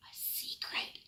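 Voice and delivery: hushed, breathless little whisper; curious whisper. Acted. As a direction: whisper it like a secret just discovered.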